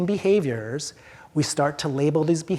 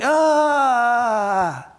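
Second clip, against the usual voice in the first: loud, drawn-out cry of distress; one long raised wail, demonstrating distress; distress sound; 6 semitones higher, 5 dB louder than usual. Shown as a demonstration. Speaker shows an audience wordless protest at noise, distressed cry. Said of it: "expressing some distress sound".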